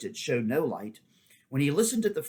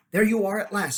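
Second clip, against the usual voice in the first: higher, louder relief; relieved greeting; 9 semitones higher, 7 dB louder than usual. A narrator's reading. Horse relieved as Shasta returns. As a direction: call out, glad he's back.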